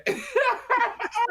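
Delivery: high burst of laughter. Real, not acted.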